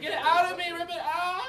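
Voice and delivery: high, loud hammed-up labour scream; teacher acting a woman screaming in labour; mock screaming. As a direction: yell it like pushing out a baby. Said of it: "we're screaming".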